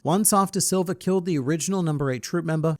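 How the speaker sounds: usual speaking voice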